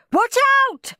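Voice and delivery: very high-pitched cry of warning; panicked dad's high shout; screamed warning. A narrator's reading. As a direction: yelp it in sudden alarm. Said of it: "screamed Dad".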